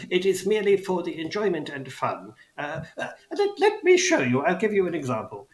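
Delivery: goblin voice jumping high as he offers; eager old goblin ticket seller; elderly goblin. Acted. Goblin offers to show the wheel, high elderly goblin.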